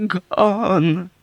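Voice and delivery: high, loud, drawn-out wail; grieving. Acted. Old woman grieving as Yossarian walks back in. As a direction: let the single word ache. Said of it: "she grieved".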